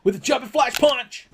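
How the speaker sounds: hammed-up wrestling commentator; excited commentator. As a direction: call it like a ringside announcer losing it. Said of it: "He'd be like".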